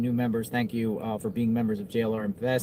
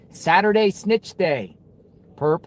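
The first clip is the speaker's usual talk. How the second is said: higher, louder, slow punchy catchphrase; hyped slogan; 6 semitones higher, 6 dB louder, slower than usual. Shown as a demonstration. Host pitching the snitch-day slogan to viewers, hyped. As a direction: sell the slogan like a promo. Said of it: "hyping the slogan".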